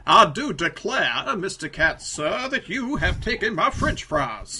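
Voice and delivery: loud, slow hammed-up Southern drawl; mock old-time US Southern accent, swooping high; Foghorn Leghorn Southern. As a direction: parody a Civil War Southern gentleman. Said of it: "bizarre foghorn leghorn southern, U S southern accent".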